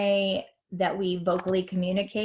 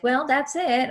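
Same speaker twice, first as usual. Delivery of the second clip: awkward trailing ending; 7 semitones higher, 5 dB louder than usual. Shown as a demonstration. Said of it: "you also don't want to end with".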